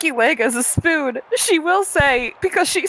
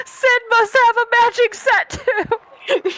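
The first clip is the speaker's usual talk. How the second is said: yelling; 10 semitones higher, much slower than usual. Acted. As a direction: yell it out after him. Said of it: "Ishri yells out at Walker".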